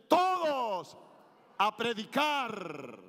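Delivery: rally them like a commander; military harangue. Shown as a demonstration. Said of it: "como si fuera una arenga militar". (arenga militar gritada)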